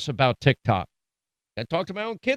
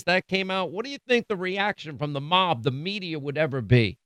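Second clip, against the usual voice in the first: ordinary talk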